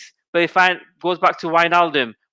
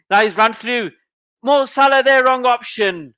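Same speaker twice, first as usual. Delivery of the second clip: excited burst; 8 semitones higher than usual. Real, not acted. Chance on goal, then error.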